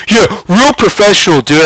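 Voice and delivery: high-pitched mocking heckle; Beaker imitation. Rival heckling after throwing a water bottle, high mocking imitation. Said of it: "he sounded just like Beaker from the Muppets".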